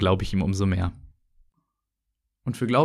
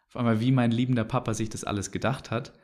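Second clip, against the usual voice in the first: ordinary delivery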